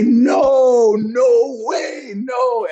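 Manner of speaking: high shouted mock denial; fake angry protest. Manager faking anger at umpire, high shouted no. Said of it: "acting like I'm actually mad at him".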